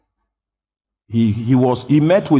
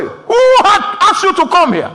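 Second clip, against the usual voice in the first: high, loud, scornful demand; angry rebuke; 6 dB louder than usual. Retold or imitated. Prophet chasing off the crowd, high loud scorn. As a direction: drive them away with your voice. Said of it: "He drove them away".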